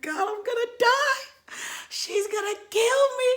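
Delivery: very high wailing dread; mock panic. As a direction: wail in hammed-up terror. Acted panic at coming punishment.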